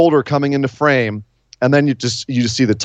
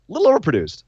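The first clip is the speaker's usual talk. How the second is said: mock voice; 6 semitones higher, faster than usual. Shown as a demonstration. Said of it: "repeats in altered voice".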